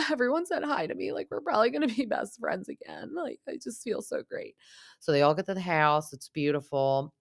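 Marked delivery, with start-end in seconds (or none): 0.1-4.5 much higher, fast gushing mimicry; mock him as giddy and smug; high mocking impression of Tom. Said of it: "he's like".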